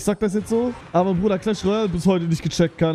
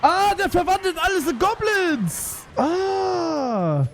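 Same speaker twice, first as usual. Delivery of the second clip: high wailing dismay; 12 semitones higher, much slower than usual. Real, not acted. Dismay at goblin card.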